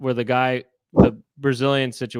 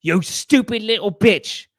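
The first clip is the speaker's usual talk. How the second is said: higher, slow put-on sneering voice; nasty passenger; 8 semitones higher, 3 dB louder, slower than usual. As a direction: sneer at the girl slowly in a higher voice. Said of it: "He went".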